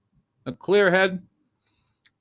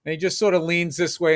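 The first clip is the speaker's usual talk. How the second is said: ordinary delivery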